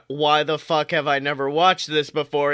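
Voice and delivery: ordinary talk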